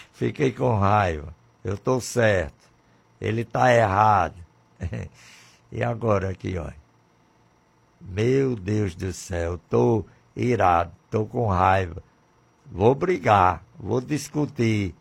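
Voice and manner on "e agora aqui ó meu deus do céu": normal talking voice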